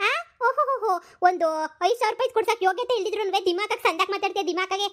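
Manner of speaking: high mocking laugh running into the taunt; scornful laugh. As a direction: laugh at him, then taunt. Wife laughs scornfully at husband.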